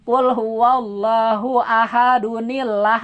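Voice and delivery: slow, ahadunillah joined with no bounce on d; slow recitation read through; connected, no qalqalah. Shown as a demonstration. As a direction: run ahadunillah on in one breath.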